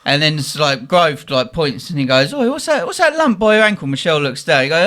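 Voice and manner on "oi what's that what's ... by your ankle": high-pitched "Oi" of discovery; retold startled question. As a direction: call it out high, as if just spotting it. Retold question about a lump.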